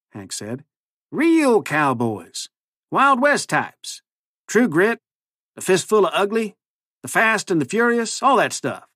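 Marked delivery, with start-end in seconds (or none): 1.1-8.9 high, drawn-out cowboy drawl; cowboy accent. A narrator's reading. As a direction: play a would-be cowboy, slow and high. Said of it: "his ridiculous new accent".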